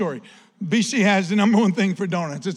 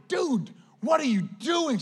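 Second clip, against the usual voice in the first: mock shout; 6 semitones higher than usual. Yelling at teammate again.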